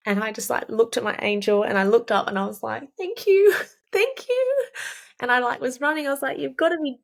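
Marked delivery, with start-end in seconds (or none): 3.0-4.7 high, loud thanks with a laugh; overjoyed thanks. Retold or imitated. Thanking her angel mid-race. 6.4-7.0 high, rising amazement; stunned disbelief. Retold or imitated. Pain vanished, stunned disbelief.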